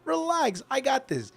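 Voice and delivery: high, soft easygoing reassurance; reassuring. Retold or imitated. Customer telling the worker he'll train the new guy, reassuring. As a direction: lift the voice high and keep it light.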